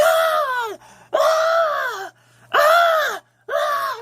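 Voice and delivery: hammed-up wail of a man hit by acid; imitated screaming. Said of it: "he's screaming like".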